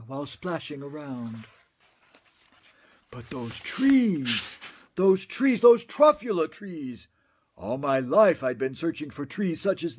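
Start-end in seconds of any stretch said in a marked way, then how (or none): 3.1-7.1 slow start swelling to louder delight; building rapture. Acted. Onceler adoring the truffula trees, building rapture. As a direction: grow from awe to joy.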